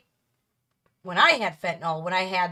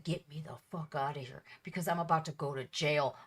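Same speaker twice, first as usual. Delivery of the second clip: low, much softer seething threat; low seething anger; 6 semitones lower, 9 dB quieter than usual. Retold or imitated. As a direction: hold the rage down low and quiet. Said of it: "I was that mad".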